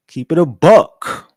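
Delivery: belted catchphrase. Shown as a demonstration. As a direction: stretch "buck" like a hype line.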